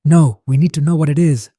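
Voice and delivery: loud, urgent snap; snapped refusal. A narrator's reading. Narrator refuses to flee without answers, snapping loudly. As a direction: cut in forcefully to refuse. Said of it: "I snapped".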